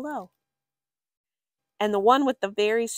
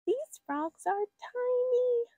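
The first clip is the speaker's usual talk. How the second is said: much higher and slow, small and dainty; tiny squeaky voice; slower than usual. A narrator's reading. Reading about tiny frogs.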